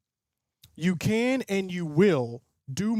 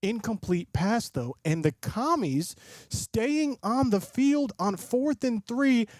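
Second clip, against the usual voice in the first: raised, brisk play-by-play call; higher-pitched sports broadcaster voice; live play-by-play; 5 semitones higher than usual. Acted. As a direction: call the drive like a TV announcer.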